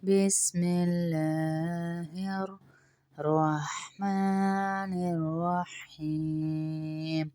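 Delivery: slow, soft, measured recitation; Quranic recitation. Acted. Teacher opens the lesson with slow recitation. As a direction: recite it slowly and reverently.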